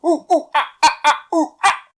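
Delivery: loud, high monkey whoops; excited "ooh-ooh, ah-ah" monkey call; monkey chatter. A narrator's reading. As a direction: hoot and screech like a monkey. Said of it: "came the reply".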